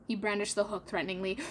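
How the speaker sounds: plain reading voice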